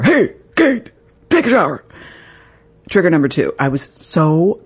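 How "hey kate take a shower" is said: mimicking husband. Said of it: "tells one of the kids to take a shower".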